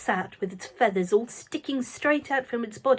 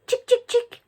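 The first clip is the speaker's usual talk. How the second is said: coaxing chick call; 7 dB louder than usual. A narrator's reading. Coaxing the hen.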